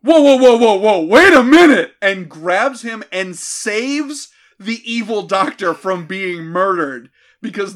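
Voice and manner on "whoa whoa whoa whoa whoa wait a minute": loud, higher, hammed-up whoa; phone demon stopping her. Phone demon halting the murder, loud hammed-up cry. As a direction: bellow to halt her like a panicked demon. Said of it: "the phone demon goes".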